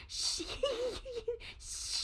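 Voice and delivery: soft, drawn-out shushing; hushing. Retold or imitated. Gigglers at a sleepover, hushing. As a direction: shush through suppressed giggles. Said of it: "giggling maniacally, but they're like".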